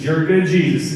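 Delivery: normal talking voice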